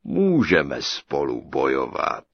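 low, slow, ponderous giant. A narrator's reading. Giant accepts the tailor's challenge, low slow voice. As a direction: roll it out slow and deep.

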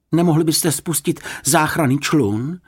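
high, eager hopeful question. A narrator's reading. Boy asking lifeguard for a rescue boat, hopeful. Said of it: "dělal si naděje Jonatán".

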